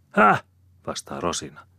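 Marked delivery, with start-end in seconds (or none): 0.1-0.4 very high, loud "huh?"; squawked challenge back at him; high-pitched, combative comeback; defiant retort. A narrator's reading. Woman retorts in acted quarrel.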